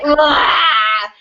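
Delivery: high, loud swooning shriek; excited squeal. Retold or imitated. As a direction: squeal like a fan overwhelmed. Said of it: "And I'm just like".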